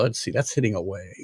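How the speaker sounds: usual speaking voice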